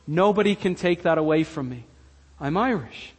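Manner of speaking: usual speaking voice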